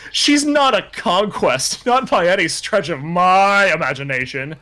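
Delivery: high, drawn-out, hammed-up indignation; indignant protest. Hero defending his crush in his head, overblown indignation. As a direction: protest grandly, swelling on "my".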